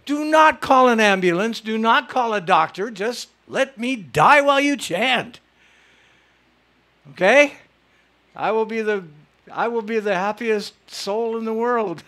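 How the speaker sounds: gleeful instructions. Pretend. Telling the audience his wish to die in kirtan, joyful loud orders. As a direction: lay down the rules with delighted glee.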